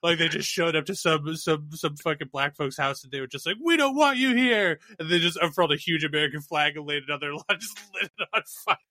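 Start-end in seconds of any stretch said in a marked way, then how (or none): 3.6-4.8 mock hostile. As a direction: call it out slowly in a high mock voice. Acting out racists at a house.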